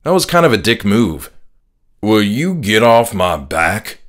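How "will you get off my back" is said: slow, irritated Southern drawl; annoyed country drawl. A narrator's reading. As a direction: grumble it like a fed-up rural dad. Dad snaps at son.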